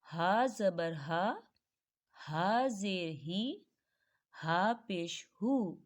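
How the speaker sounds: हा ज़बर हा, हा ज़ेर ही, हा पेश हु drawn out slowly; slow teacher's drill of हा; very slow spelling drill. Shown as a demonstration. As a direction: spell the letter हा with each vowel, very slowly. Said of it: "हा की मिसाल को देखें".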